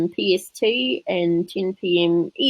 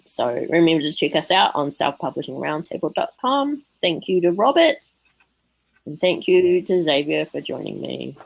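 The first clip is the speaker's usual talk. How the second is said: normal talking voice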